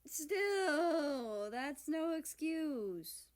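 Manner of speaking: slow, sulky huff; huffy. Acted. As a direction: sulk it out slowly. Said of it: "America huffed".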